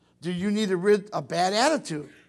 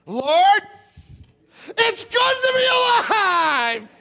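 high, slow, loud joyful cry; joyful shout; 13 semitones higher, 9 dB louder, much slower than usual. Shown as a demonstration. Preacher shows teens how to greet the day, loud and joyful. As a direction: shout your thanks to the morning. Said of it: "You ought to get up in the morning and say".

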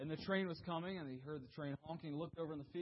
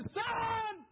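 high, loud final scream; one last desperate call; 7 dB louder than usual. Retold or imitated. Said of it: "he screamed over".

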